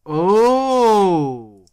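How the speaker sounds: loud sudden oh; surprised gasp. Real, not acted. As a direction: realize it all at once, loudly. Sudden realization, loud exclaim.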